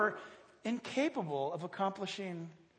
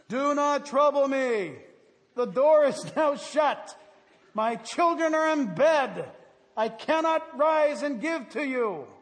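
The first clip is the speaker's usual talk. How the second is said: slow, louder, put-out neighbor refusing from behind the door; grumpy neighbor; 5 semitones higher, 7 dB louder, slower than usual. Acted. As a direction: play the annoyed sleepy neighbor, dragging the words. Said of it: "This voice comes from within the house".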